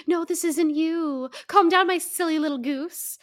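hammed-up gentle parent voice. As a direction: coo at him like a fussy toddler. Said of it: "trying to gentle parent him".

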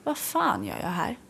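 a private thought in a taxi, said under the breath; softly asking herself in the rush hour taxi; quiet inner thought. Retold or imitated. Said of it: "bara tänkte jag så här".

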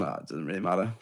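soft dismissive shrug; dismissive mock voice. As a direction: wave it off lightly. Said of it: "they've just gone".

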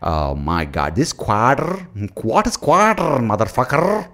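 mock Indian accent with American R's, rising high; exaggerated Indian accent, every final R drawn out; Indian accent, rolling R's. Mocking Indians in Seattle, Indian accent with heavily rolled R's. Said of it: "ninety-nine percent Indian accent, but that's few words with the rolling R's".

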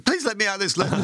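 high-pitched mock desperation; retold desperate plea. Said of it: "I was going".

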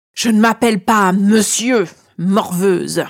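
booming, cavernous dragon interruption. A narrator's reading. Dragon cutting off the flattering girl, cavernous voice. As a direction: cut in like an affronted dragon, cavernous. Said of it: "me coupe-t-il d'une voix caverneuse".

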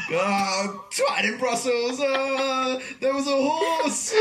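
put-on drunk phone call. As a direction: imitate the drunk friend calling her. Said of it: "He called me up".

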